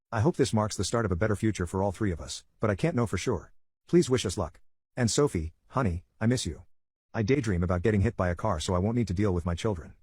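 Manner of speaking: ordinary delivery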